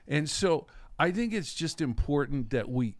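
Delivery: usual speaking voice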